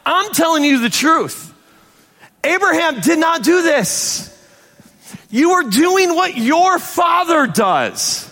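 high-pitched, emphatic preacher's delivery; impassioned scripture reading. Acted. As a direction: read Jesus' rebuke with rising urgency. Reads scripture as Jesus, forcefully.

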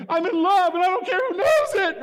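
high, loud Elf-style gush; a high, giddy shout of love; giddy Elf quote. Retold or imitated. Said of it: "that's what my heart was saying".

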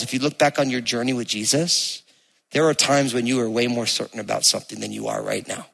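ordinary delivery

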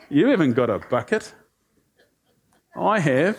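smug retort with a laugh; lightly mocking, laughing as you win; sassy. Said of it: "A little bit sassy".

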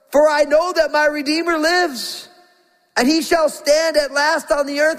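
high, full preaching cry of hope; fervent proclamation. Acted. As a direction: proclaim it like a triumphant shout of faith. Job's cry retold with rising hope.

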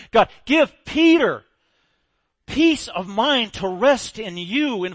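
loud, slow, pleading prayer to God; fervent prayer. Acted. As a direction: cry it out to heaven slowly. Voicing the church's prayer.

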